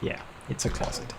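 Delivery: breathy whisper under the breath; a flat, let-down whisper. Real, not acted. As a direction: whisper "it's a closet" to yourself. Repeats the words under breath.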